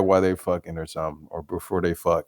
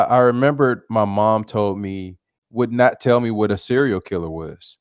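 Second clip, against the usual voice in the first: normal talking voice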